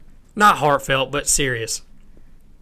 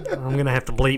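laughing. Real, not acted. Laughs at own slip.

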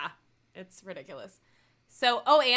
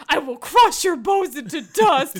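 menacing growl; 3 semitones higher, 10 dB louder, slower than usual. Retold or imitated. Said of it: "And he's like".

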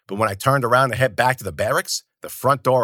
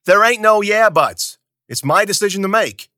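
angry cut-off; 10 semitones higher, 6 dB louder than usual. Acted. Zoot cutting Bruno off.

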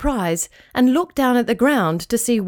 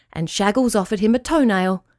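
usual speaking voice

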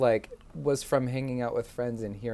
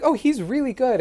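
much higher, louder, slowed gush of praise; gushing imitation; 13 semitones higher, 7 dB louder than usual. Retelling her praise of him, high gushing imitation. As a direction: gush like a delighted star. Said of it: "She was like".

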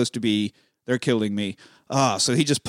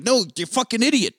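higher-pitched mock outburst; exasperated retort; 8 semitones higher than usual. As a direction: snap it like a fed-up friend. Acted quote of exasperated argument.

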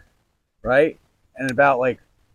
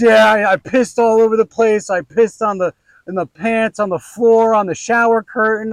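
mock wife confession; 8 semitones higher, 7 dB louder than usual. Wife voiced listing mess.

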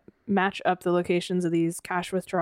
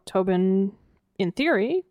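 ordinary talk, nothing marked

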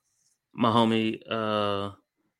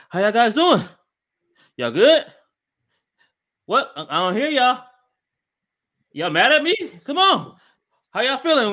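high, loud fitness-instructor hype; hype coach shout; 13 semitones higher, 8 dB louder than usual. Retold or imitated. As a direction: pump up a tired class, full volume. Cardio teacher hyping up class.

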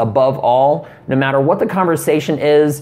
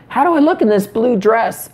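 lightly voiced girlfriend; 7 semitones higher than usual. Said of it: "stay away from amazing".

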